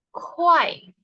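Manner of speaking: Kuai with a high start, falling sharply. Shown as a demonstration. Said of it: "nadanya itu turun ke bawah". (Kuai dengan nada tinggi turun ke bawah)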